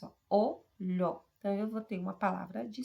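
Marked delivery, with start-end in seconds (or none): 0.3-1.2 "Olho" split into two separate beats, o-lho; syllable by syllable. Shown as a demonstration. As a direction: say "Olho" as two distinct voice pulses. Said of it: "Tenho duas emissões de voz".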